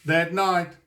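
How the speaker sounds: plain reading voice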